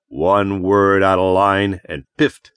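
a slow, lightly voiced cop threat; slow menacing police warning. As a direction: warn him off, slow and hard. Cop threatens with stun pistol.

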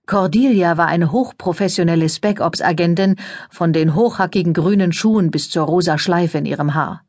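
ordinary delivery

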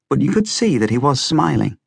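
plain reading voice

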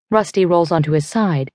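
usual speaking voice

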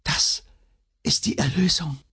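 high, halting dying voice; failing, stammered last words; faint stammering. A narrator's reading. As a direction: break off after the first word, then finish high and feebly. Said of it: "vermochte sie nur noch zu stammeln".

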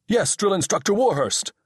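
high, crisp answering voice; eager assistant. A narrator's reading. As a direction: snap back at the superior.

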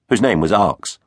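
ordinary delivery

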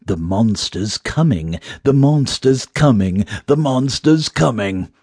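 haunting sing-out taunt; mysterious taunting voice. A narrator's reading. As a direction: chant a teasing warning from the dark. Mysterious voice taunts the running heroine.

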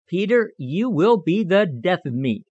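plain reading voice

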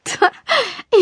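high burst of giggling; laughing. A narrator's reading. Julia finally giving in and laughing at the joke, high giggle. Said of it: "Julia lachte jetzt doch".

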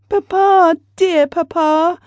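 put-on high, pleading child's voice; frightened child clinging to her papa; crying little girl. A narrator's reading. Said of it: "cried Blondine".

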